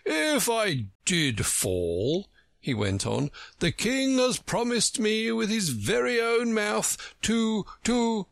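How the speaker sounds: a slow, lofty, self-important egg; solemn and grand. A narrator's reading. Humpty Dumpty boasting to Alice, solemn and grand. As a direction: drag it out, puffed up with pride. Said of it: "looked so solemn and grand".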